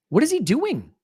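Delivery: high, incredulous swoop on the question; exasperated, pitch leaping way up; exasperated outcry. Real, not acted. Fan baffled by referee Paul Tierney, exasperated outcry.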